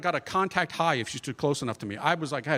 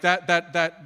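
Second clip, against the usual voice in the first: ordinary talk, nothing marked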